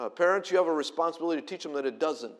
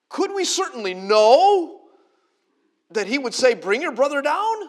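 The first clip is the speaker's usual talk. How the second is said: protesting brothers; 11 semitones higher, 13 dB louder than usual. Acted. Brothers protesting to their father, high and loud. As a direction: plead that nobody could have known.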